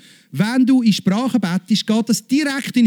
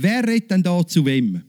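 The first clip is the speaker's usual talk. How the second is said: ordinary talk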